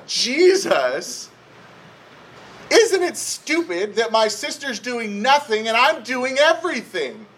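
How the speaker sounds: high-pitched, mildly exasperated complaint; mock indignant yell. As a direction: complain like a put-upon sister, hammed up. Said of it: "she goes and she yells at Jesus".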